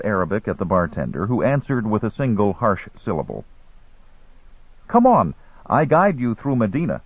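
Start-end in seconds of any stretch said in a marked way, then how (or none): 4.9-7.0 put-on higher-pitched street boy; boy hustler in broken English. A narrator's reading. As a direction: coax like a young guide.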